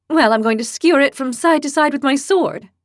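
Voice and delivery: put-on high, loud, boastful girl; fierce young heroine. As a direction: declare it like a hotheaded girl. Lily boasts again.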